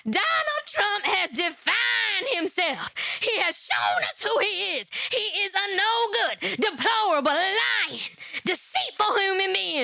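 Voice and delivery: hammed-up Maxine Waters rant; shouting mock congresswoman speech; Maxine Waters impression. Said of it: "let me get my Maxine Waters".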